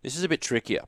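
ordinary talk

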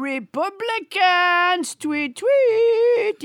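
slow "Republicans", then a high mock-chirped "tweet, tweet"; French Trump puppet. Puppet Trump teases the host, chirping "tweet, tweet". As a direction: taunt like a bird. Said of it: "French Trump".

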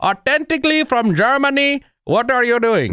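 high-pitched cartoon German accent. Host proving his German accent is spot on, German accent. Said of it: "German accent".

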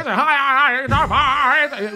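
high, sing-song announcer call; mock the announcer's high call; home run call. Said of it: "does he go".

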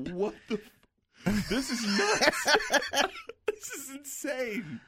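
slow words pushed out through high laughter; laughing while talking. Real, not acted. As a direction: laugh your way through the line. Laughing through his disbelief.

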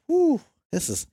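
very high, choked-up whoo; very high overcome whoo after finding the deer; emotional whoo. Retold or imitated. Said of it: "I'm getting all emotional here".

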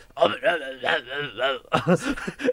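drawn-out throaty wordless mumble; old Spaniard's garble. Retold or imitated. Host mimics very old Spaniards, garbled throaty mumbling. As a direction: imitate an elderly Spaniard's unintelligible noise. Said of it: "ese como sonido raro".

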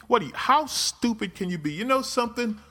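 high, strained exasperated rant. Real, not acted. Scolding listeners about insurance, high exasperated rant. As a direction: rail at their stupidity.

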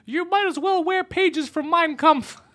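high, slow mock outrage; outraged lady. Host imagining a lady missing the irony, high mock outrage. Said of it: "you're gonna fall on a lady".

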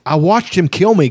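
lightly voiced big warrior, in tears; wretched crying. A narrator's reading. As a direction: cry it out like a broken man. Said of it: "Karaman cried wretchedly".

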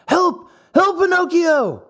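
high, frightened plea; panicked cry for help. Acted. Lampwick crying to Pinocchio for help in panic. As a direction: call out in sudden terror. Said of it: "turned to Pinocchio and said".

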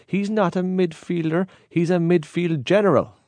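high-pitched imitation of a boastful Cork man; exaggerated Cork accent, high and grand; mock Cork accent. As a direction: put on a Cork accent, pitched well up, starting slow. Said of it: "I think I do a Cork accent".

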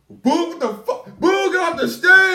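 high, drawn-out jeering shout; heckling crowd. Retold or imitated. Host imitating a festival crowd booing [name], high slow shouting. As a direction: boo him off like an angry crowd. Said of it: "to hear a whole crowd of people go".

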